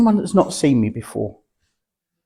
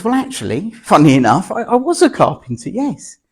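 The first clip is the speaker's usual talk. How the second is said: lightly voiced old man, much higher pitch; a touch of surprised pride; the man in heaven admitting he was a carpenter; 8 semitones higher, 5 dB louder than usual. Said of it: "And the man says".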